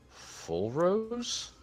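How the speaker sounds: sharp high-pitched disbelieving question; stunned to recognise her; surprised. Acted. Wounded giant, waking, recognises the player, surprised.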